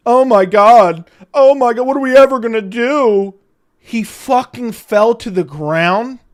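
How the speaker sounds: loud sarcastic fake panic; mock outrage.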